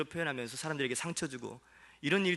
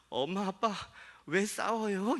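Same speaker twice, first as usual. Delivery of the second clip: lightly voiced little daughter, a bit higher; child's question; 7 semitones higher, slower than usual. Pastor voicing his young daughter asking why parents fight. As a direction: mimic a small child asking. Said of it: "이렇게 묻더라고요".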